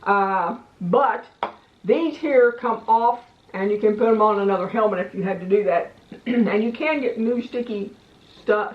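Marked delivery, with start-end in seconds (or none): none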